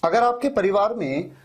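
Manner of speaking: ordinary delivery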